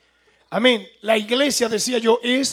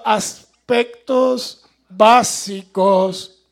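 aspectos básicos, sílaba por sílaba, muy lento y alto; muy despacio y claro; 6 dB louder, much slower than usual. Shown as a demonstration. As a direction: deletrea aspectos básicos despacio para no trabarse otra vez. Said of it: "Ahora sí". (aspectos básicos pronunciado muy lento y marcado)

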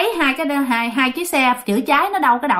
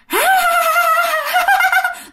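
ghost's shrill laugh. Retold or imitated. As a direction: cackle down the phone line. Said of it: "cười nha các bạn". (tiếng ma cười the thé)

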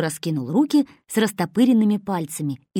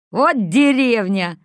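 slow, louder, condescending character voice; 5 semitones higher, 6 dB louder, slower than usual. A narrator's reading. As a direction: talk down to a simpleton. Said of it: "снисходительно сказал Косой". (снисходительно, медленно и громче)

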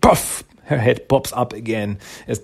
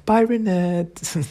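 slow, dreamy, higher sigh of a goodbye; lovestruck goodbye; 7 semitones higher, much slower than usual. Retold or imitated. Mike swooning over Renette as she leaves, slow lovestruck sigh. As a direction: say it with hearts in your eyes. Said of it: "his speech bubble is like a red heart".

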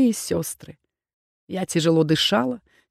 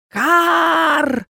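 raven cry; 8 semitones higher, 3 dB louder than usual. A narrator's reading. Raven bursts through.

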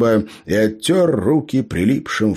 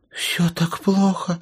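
gasping Tasya; 12 semitones higher, slower than usual. A narrator's reading. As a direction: gasp it out, appalled. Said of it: "ахнула Тася".

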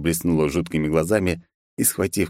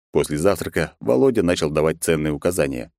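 usual speaking voice

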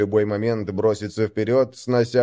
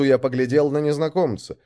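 plain reading voice

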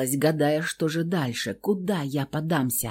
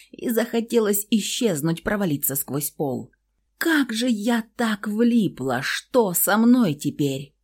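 plain reading voice

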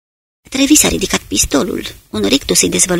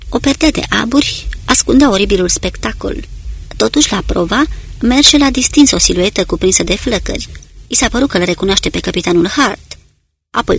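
ordinary delivery, nothing marked

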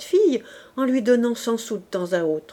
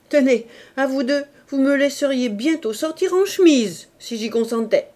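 furious; 2 semitones higher, 6 dB louder than usual. Acted. Woman furiously berating her lover, furious. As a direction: rage at him without pause. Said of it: "tout à fait furieuse".

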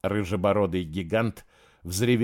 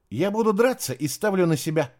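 excited; 8 semitones higher than usual. A narrator's reading. Heroine demanding to fight.

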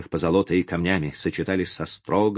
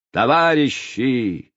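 roaring rallying speech; 6 semitones higher, 4 dB louder, slower than usual. A narrator's reading. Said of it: "обратился к войскам".

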